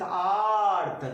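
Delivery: आर्थ with the आ held long and slow; drawn-out aa. Shown as a demonstration. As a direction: stretch the आ of आर्थ. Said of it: "आ क्या है".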